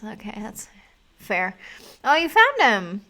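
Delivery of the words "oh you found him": excited. Real, not acted. Excited at the found rabbit.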